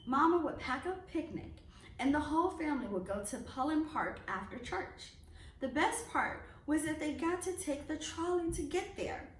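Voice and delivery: ordinary delivery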